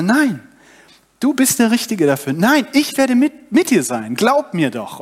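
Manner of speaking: hoch und eindringlich zureden; drängend überzeugen; nachdrücklich beharren. Acted. Gott widerspricht Mose beharrlich. (Gott redet Mose eindringlich zu)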